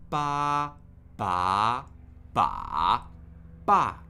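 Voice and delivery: emphatic four-tone drill on "ba"; four Mandarin tones. Shown as a demonstration. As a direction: run "ba" firmly through four tones. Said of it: "warm up with a slightly different syllable".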